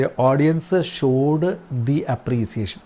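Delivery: very slow, word by word; slow dictation. Shown as a demonstration. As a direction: read it out slowly for the class to write. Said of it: "അവിടെ നമ്മൾ എന്ത്". (വളരെ പതുക്കെ, വാക്കോരോന്നായി)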